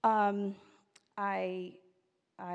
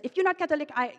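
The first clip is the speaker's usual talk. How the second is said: mom yells the words at her, loud and higher; angry mom shout; 6 semitones higher, 8 dB louder, faster than usual. Retold or imitated. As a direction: cut off mid-sentence in a shout. Mom yelling before hanging up.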